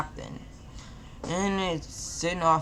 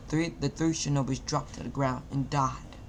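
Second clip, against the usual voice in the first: plain reading voice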